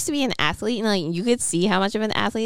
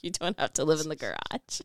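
mild mom voice; 3 semitones lower, 7 dB quieter than usual. Retold or imitated. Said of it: "she was like".

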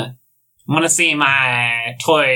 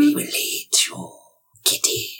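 hissing snake whisper; 11 semitones higher, 6 dB quieter than usual. Acted. Snakes threaten the cat.

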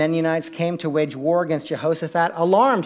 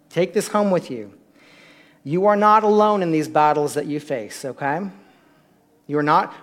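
usual speaking voice

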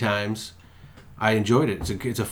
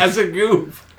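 laughing echo; 7 semitones higher, slower than usual. Real, not acted. Echoing joke through laughter.

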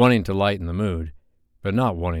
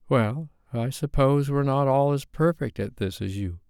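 sarcastic Sophie; 7 semitones higher than usual. A narrator's reading. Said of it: "Careful with the smart mouth".

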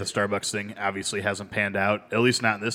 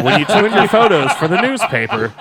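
put-on old man voice; 9 semitones higher, 8 dB louder than usual. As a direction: talk like a cranky old grandpa. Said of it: "you sound like Pawpaw when you're talking like that".

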